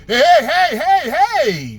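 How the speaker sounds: loud, high hey hey cutting in; shouted interruption. Retold or imitated. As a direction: holler to stop someone mid-flow. Cutting off the gushing woman.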